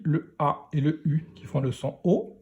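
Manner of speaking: ordinary talk, nothing marked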